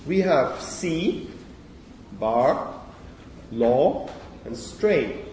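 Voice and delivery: reading out example words; demo words. Shown as a demonstration. Teacher sounding out words for the class. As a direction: sound out each vowel for the class. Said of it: "Short or long?".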